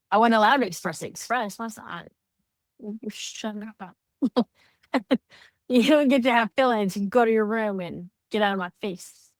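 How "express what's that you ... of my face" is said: mock scolding parent, a bit higher and louder; imitating angry parent. Imitating her parent scolding her as a child, mock angry. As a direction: sneer like the strict parent shutting a kid down.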